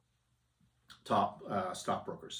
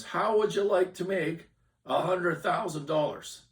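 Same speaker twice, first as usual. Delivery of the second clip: higher and louder, calling over the crowd; yelling across the bar; 9 semitones higher, 5 dB louder than usual. Retold or imitated. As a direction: pitch the offer across a loud room. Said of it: "He's yelling across the bar".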